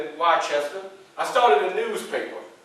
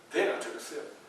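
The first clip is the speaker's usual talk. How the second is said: normal talking voice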